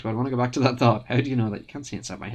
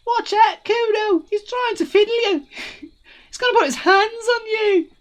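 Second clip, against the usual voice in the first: put-on much higher taunting kid voice; squeaky laughing sidekick; 6 dB louder than usual. Kuno's friend mocking the cop to Kuno, high and laughing. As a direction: warn Kuno, half laughing.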